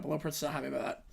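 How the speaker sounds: usual speaking voice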